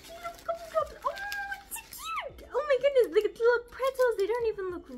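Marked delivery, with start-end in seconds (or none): 0.1-1.1 cute squeal. Real, not acted. Squealing over tiny decorations. 1.7-2.5 much higher, softer squeal; high cutesy squeal. Real, not acted. Vlogger cooing over her little gingerbread pieces, squealing.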